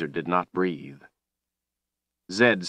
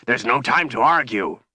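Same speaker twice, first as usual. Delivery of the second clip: louder, raised, urgent command; bellowed; 5 semitones higher, 4 dB louder than usual. A narrator's reading. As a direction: bellow it to end the argument. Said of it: "Chase bellowed".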